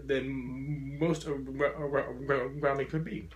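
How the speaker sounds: real stutter, repeating a word's first sound. Real, not acted. Speaker telling an audience about being heard, stuttering. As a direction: get stuck on the word, then push through. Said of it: "as someone with a very profound stutter".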